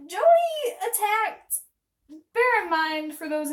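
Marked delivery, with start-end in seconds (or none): 0.1-1.6 dramatic alarm. Retold or imitated. Reading mom's alarming text.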